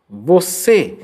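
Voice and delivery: ordinary talk, nothing marked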